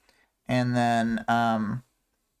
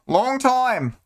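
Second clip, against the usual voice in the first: a hammed-up Boston accent, pitched high and louder; 12 semitones higher, 3 dB louder than usual. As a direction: blurt it out in a jokey Boston accent. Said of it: "with his Boston accent".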